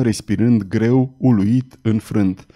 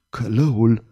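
gasped; 3 semitones lower than usual. A narrator's reading. The cardinal gasping out the executioner's name. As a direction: gasp it out in dread. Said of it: "gâfâi cardinalul".

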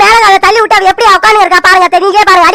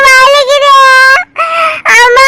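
put-on higher, childlike pained voice; hurt child whimper; 5 semitones higher, much slower than usual. Hurt little girl telling mother it aches, childlike pained voice.

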